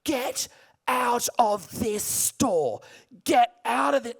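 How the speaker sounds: loud, high bellow telling her to get out. Retold or imitated. Shop owner bellows at the customer, loud raging shout. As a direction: roar it like you're throwing someone out. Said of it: "he is now bellowing at me".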